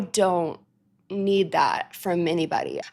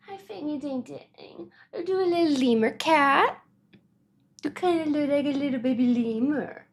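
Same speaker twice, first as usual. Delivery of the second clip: pet talk; 5 semitones higher than usual. Real, not acted. Cooing to her cat.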